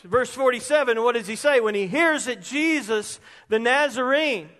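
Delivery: ordinary talk, nothing marked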